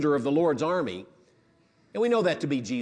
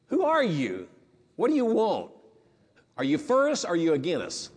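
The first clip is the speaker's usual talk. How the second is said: wary questioning; 3 semitones higher than usual. Retold or imitated. Joshua questions the stranger.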